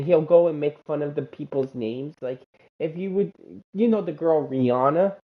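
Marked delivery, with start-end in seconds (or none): none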